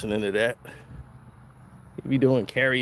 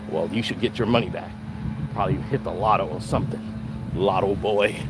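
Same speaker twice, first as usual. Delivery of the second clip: dismissive rich homeowner; 7 semitones lower, 4 dB quieter than usual. Retold or imitated. [name] mocking intruder as lottery winner.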